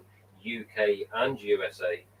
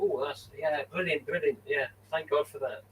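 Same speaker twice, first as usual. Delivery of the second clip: higher, gushing fake relief; mock delighted reaction; 6 semitones higher than usual. Recalling his reaction to the set delivery, hammed-up relief. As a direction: play up the relief, a bit hammed-up. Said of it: "I was like".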